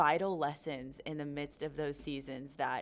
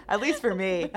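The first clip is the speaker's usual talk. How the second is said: laughing it out, higher and louder; real laugh carrying the words; 12 semitones higher, 14 dB louder than usual. Real, not acted. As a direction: squeeze the words out through a laugh. Both hosts laughing at the joke.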